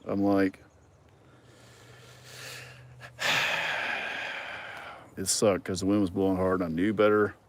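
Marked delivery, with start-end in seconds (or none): 3.0-4.9 long, voiceless exhale; drawn-out breathy sigh. Retold or imitated. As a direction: let out a long, fading sigh. Recalling the crashed drone.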